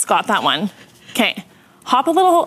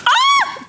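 sudden high scream; loud scream. Real, not acted. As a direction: let out a startled shriek. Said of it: "that was a loud scream".

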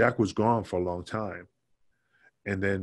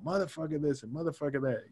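higher, softer imitation of ranting; mock cursing storm; 5 semitones higher, 5 dB quieter than usual. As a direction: imitate someone cursing on and on, half-voiced. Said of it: "he's cursing up a storm".

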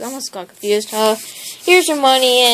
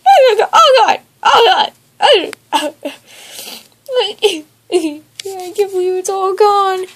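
high despairing groan; 3 semitones higher than usual. Acted. Despair over lost money.

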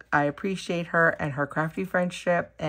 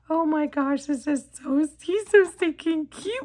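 ordinary talk

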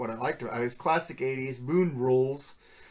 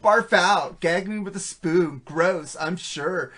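put-on Valley Girl drawl, stretched slow; 3 semitones higher, slower than usual. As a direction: imitate a ditzy Valley Girl. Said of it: "doing all the freaking Valley Girl talk".

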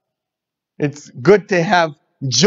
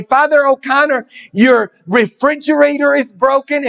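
high, urgent call to the priest; fussing housekeeper; 9 semitones higher than usual. Retold or imitated. Housekeeper hurries to the priest with a mock fluster. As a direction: run in fretting at Father.

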